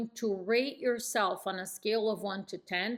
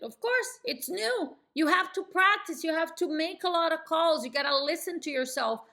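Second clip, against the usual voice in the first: scripted sound; 6 semitones higher than usual. Shown as a demonstration. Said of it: "sounding scripted".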